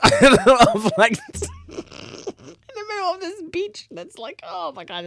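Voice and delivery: high, drawn-out giggling words; laughing through the words at the absurd setting. Real, not acted. Creator laughing at her comic's beach setting, giggly.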